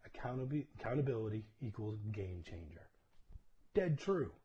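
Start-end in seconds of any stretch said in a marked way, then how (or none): 3.7-4.3 high, loud burst of agreement; emphatic exclamation. Real, not acted. Pastor agreeing with a viewer comment, loud and high. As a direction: punch it out with conviction.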